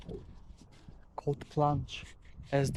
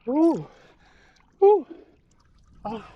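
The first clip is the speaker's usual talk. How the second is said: cold shock gasps; 12 semitones higher, 7 dB louder than usual. Real, not acted. Plunging into the ice hole.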